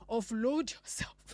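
slow, lightly voiced pastor's catchphrase; Pastor Lapo's catchphrase. Quoting a pastor's saying to the congregation. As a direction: echo the pastor's slow drawn line. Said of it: "Pastor Lapo will say".

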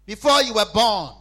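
loud, slow, high preaching cry; loud pulpit cry, drawn out; preaching shout. Acted. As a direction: proclaim it at full force. Preaching at full force.

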